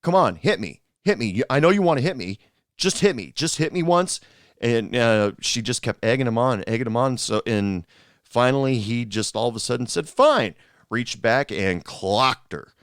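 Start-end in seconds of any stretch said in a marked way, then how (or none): 0.0-4.2 half-voiced retold screaming taunt; mock scream. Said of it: "she started screaming". 10.1-10.5 high, loud mock outburst; sudden fed-up retort; exasperated snap. Retold man snaps after goading.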